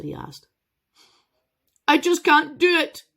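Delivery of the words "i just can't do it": high, loud wail of despair; upset dragon wailing to the boy. Acted. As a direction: cry it out like a heartbroken beast. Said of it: "the dragon wailed".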